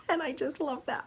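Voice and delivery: high, laughing delight. Real, not acted. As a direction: giggle through it. Laughing at earthy toe.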